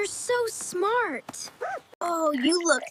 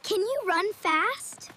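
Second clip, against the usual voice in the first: ordinary delivery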